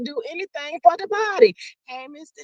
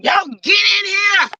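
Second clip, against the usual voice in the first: calling out; 8 semitones higher than usual. Real, not acted. Calling viewers into the live.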